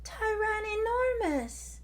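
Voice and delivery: very high squeaky cry; squeak. Acted. Terry calls for her brother, very high squeak. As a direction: squeak it in a tiny voice. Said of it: "squeaked Terry".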